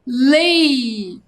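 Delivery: "Lei" held long, gliding into the i; sounded-out syllable. Shown as a demonstration. Teacher asking pupils to join in at home, "Lei" stretched.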